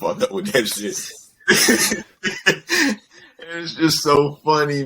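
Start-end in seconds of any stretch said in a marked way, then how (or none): 0.0-2.0 slow, laughing mock brush-off; laughing disbelief. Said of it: "He was like". 2.2-2.9 high-pitched burst of laughter. Real, not acted. Laughing at own story.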